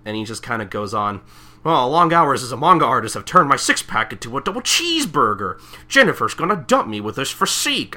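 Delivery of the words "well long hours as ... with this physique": lightly raised silly dude voice; half-hammed whining about his belly; goofy guy voice. Recapper voicing the artist's complaint, mild goofy whine. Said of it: "I don't know why he has that voice".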